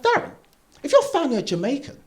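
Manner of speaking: high-pitched, innocent questioning; clueless girlfriend. Acted. Girlfriend asking a mood-killing question, high and clueless. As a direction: put on a high, naive girlfriend voice.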